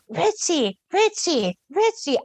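panicked calling. Retold or imitated. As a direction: mock-scream a name for help, rising each time. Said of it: "I am screaming at the top of my lungs".

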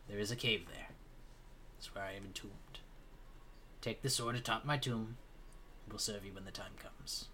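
quiet, fading, grave spirit voice; solemn ghost. Acted. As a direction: speak softly like a fading ghost. Spirit gives quiet directions.

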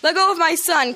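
a dad's mock bellow, with a laugh in it; father bellowing at the monster that seized his son. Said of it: "Carl bellowed".

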